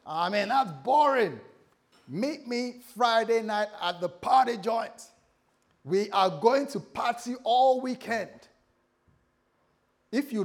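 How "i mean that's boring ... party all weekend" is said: loud, high party-animal sales pitch; hyped party friend. Acted. Devil tempting young people to party, loud hyped voice. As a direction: stretch it out like a rowdy friend luring someone out.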